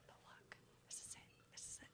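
almost soundless whispered urging; secret whisper. Retold or imitated. Daughter signals dad this is the car, secret whisper. Silent look given to dad.

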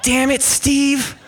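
high, exasperated mock demand; retold exasperated scolding. Confronting man under mother-in-law's bed, retold high exasperation.

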